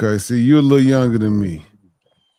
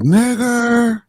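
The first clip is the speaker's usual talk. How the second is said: taunting slur; 12 semitones higher than usual. Retold or imitated. Retold racist taunt from passing cars.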